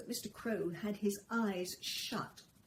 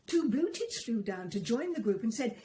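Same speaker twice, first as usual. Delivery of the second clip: plain reading voice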